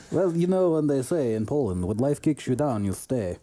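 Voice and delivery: mock-gloomy Polish accent, sinking at the end; Polish-accented gloom, played for laughs; depressed Polish accent. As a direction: deliver a fatalistic joke in a Polish accent. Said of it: "You can hear it in my voice".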